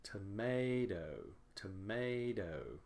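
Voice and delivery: "tomato" slow, with a long drawn-out MAY; stressed second syllable. Shown as a demonstration. Teacher showing second-syllable stress, "tomato" slowed with long MAY. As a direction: stretch and lean on the MAY in "tomato". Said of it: "the second syllable is stressed".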